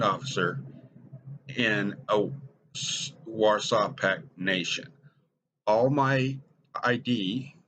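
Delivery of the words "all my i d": slow dramatic realization. Acted.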